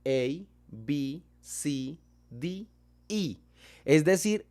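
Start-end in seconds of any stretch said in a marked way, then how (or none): none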